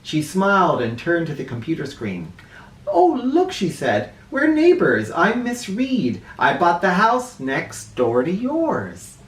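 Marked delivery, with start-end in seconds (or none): 2.9-9.2 cheerful librarian. Acted. Librarian greets kids, bright.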